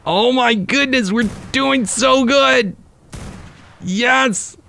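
high-pitched, drawn-out gleeful cheer; elated. Real, not acted. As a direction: celebrate a winning streak in a high voice. Celebrating success in the game.

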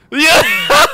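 very high, loud whooping laugh; jump scare laugh. Real, not acted. Cheering in game.

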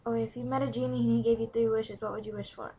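ordinary talk, nothing marked

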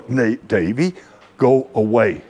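low dismissive father's voice; busy dad brushing his son away, voice dropped low. Retold or imitated. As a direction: shoo the kid off from deep in the chest.

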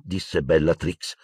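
usual speaking voice

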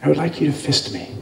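low, soft, quick request; hushed milkmaid asking boldly; coy milkmaid. Retold or imitated. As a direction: drop it softly and fast.

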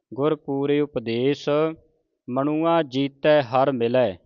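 slow, drawn-out Gurbani recitation; scripture recitation. Shown as a demonstration. Teacher models scripture for the congregation, slow measured recitation. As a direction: recite each word slowly with long vowels.

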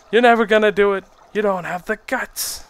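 high, goading coach voice; Rocky-style trainer. Pretend. Trainer taunting his fighter before a duel, mock goading. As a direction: needle the fighter in a put-on high voice. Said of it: "I need a trainer like in Rocky".

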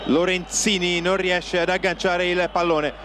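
usual speaking voice

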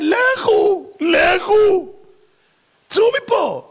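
high, desperate shouted plea; pleading shout. Acted. Pharaoh begging the Israelites to leave, shouting desperately. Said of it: "פרעה מתחנן".

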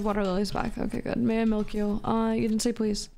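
ordinary talk, nothing marked

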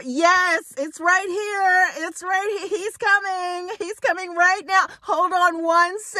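high-pitched hammed-up hollering to a driver; excited calling out. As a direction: re-enact frantic calling from the doorway, very high.

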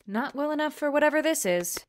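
high, loud, exasperated; angry outburst. Acted. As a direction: snap back in anger. Heated argument with intruder.

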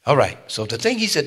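loud, high preaching; preaching at full force. Real, not acted. Pastor driving home to his congregation, loud preaching. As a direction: hammer the point home to the church.

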